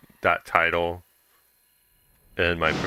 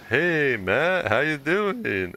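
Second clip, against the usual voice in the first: uncle's bright high hello, slow; mock-friendly uncle, pitched up and drawn out; cheery greeting; 8 semitones higher, much slower than usual.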